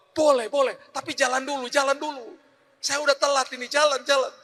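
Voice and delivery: high, quick insistence to drive on; hurried urging. Retold or imitated. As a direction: push him to start driving. (desakan cepat dengan suara tinggi)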